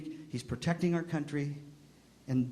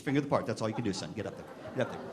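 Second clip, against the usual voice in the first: normal talking voice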